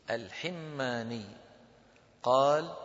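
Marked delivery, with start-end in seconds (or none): 0.1-1.3 slow "al-Him-maa-nee" for الحماني; doubled mim. Shown as a demonstration. Teacher showing students the name, slowly pronounced "al-Himmani". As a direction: hold the m in الحماني, i after the h. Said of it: "وتشديد الميم".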